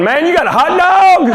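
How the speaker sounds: high-pitched, excited mock question; hammed-up thrilled kid at lunch; amazed schoolmate. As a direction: imitate an envious buddy, voice leaping up. Acting impressed school buddy at lunch.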